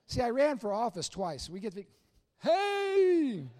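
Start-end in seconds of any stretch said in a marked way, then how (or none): 2.4-3.5 high, loud beaming hey; cheery campaign hey. Retold or imitated. Candidate greeting voters, loud beaming hey. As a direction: greet every voter with a big grin.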